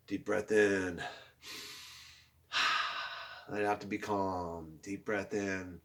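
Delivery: soft, low, calm breathing cues; guided breathing. Acted. Teacher leading the class in deep breaths, soft and calm. Leads a calming breathing exercise.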